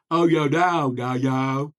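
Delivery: loud, open-mouthed, every syllable distinct; big open-mouth enunciation; wide-mouthed goofy voice. Shown as a demonstration. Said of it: "the first way that I say".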